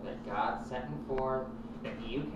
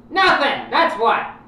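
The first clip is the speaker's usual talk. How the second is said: sudden loud, high shout; yelled punchline; 12 semitones higher, 17 dB louder, slower than usual. Acted. Talk host shouting his joke at the caller. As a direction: blast the punchline out.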